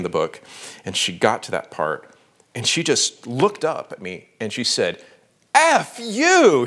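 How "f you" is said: high, loud, furious shout of F you; furious outburst. Retold or imitated. Wife reading the manuscript, outraged shout. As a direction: blurt it out at your husband in outrage. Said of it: "She was so mad".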